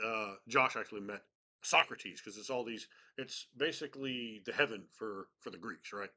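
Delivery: usual speaking voice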